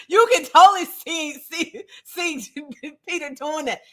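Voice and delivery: high, laughing through the words; amused. Real, not acted. Teacher tickled imagining Peter whispering to John, laughing. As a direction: giggle as you picture it.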